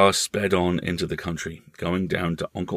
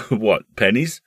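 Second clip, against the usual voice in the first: amused, louder teasing question; laughing; 4 semitones higher, 6 dB louder than usual. Acted. Uncle teasing the child about his stake, laughing. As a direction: ask it through a laugh. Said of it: "laughed the uncle".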